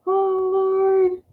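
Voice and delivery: high laughing exclamation; amused high sigh. Real, not acted. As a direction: gasp it out, tickled.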